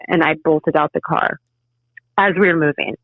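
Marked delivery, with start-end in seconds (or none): none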